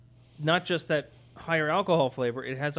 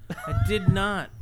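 ordinary talk, nothing marked

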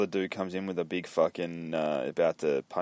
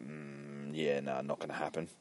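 low, much softer, offhand no; quiet refusal; 5 semitones lower, 12 dB quieter than usual. Acted. Finch refusing the young dudes, low and quiet. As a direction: drop low and soft to turn them down.

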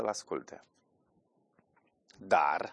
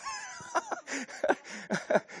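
big laugh; 5 dB quieter than usual. Real, not acted. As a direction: laugh at your own joke. Râde la gluma despre tata. (hohot de râs puternic)

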